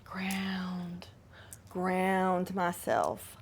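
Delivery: whispered grounding. Shown as a demonstration. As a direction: breathe and whisper to steady yourself. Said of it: "I have to ground myself".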